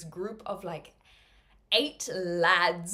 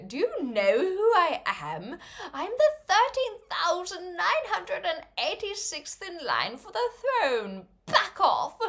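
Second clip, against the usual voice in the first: haughty royal brag; 10 semitones higher, 3 dB louder than usual. Pretend. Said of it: "they'll be".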